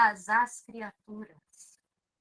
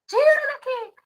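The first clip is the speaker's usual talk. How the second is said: high desperate mock cry; pretend scream; 13 semitones higher, 4 dB louder than usual. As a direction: cry out as if losing a son. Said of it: "tava gritando". (grito de mãe desesperada)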